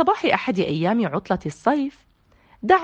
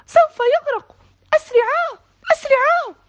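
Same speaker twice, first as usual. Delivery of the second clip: much higher, loud panicked calling; shouting for help; 5 dB louder than usual. A narrator's reading. Zein calling Mama and Papa to save Tarek, loud desperate shout. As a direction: yell for the parents at the top of her voice. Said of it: "وهي تصيح بأعلى صوتها".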